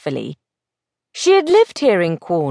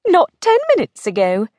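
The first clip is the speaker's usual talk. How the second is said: higher, pert retort; 8 semitones higher, faster than usual. Acted. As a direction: point it out pertly. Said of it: "she pointed out pertly".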